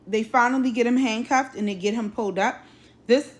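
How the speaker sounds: usual speaking voice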